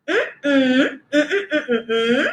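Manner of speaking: wordless humming of the request's tune; teacher humming the intonation pattern; hummed melody. Shown as a demonstration. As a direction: hum only the melody, no words. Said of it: "es el sonsonete".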